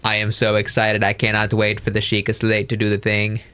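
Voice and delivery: low monotone mock enthusiasm; deadpan excitement. Player matching partner's fake excitement, deadpan. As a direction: recite your excitement on one low note.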